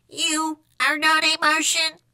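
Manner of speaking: high monotone, each word separate; Martian echo. A narrator's reading. Martian copying Skipito's challenge, monotone echo. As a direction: intone it slowly on one note.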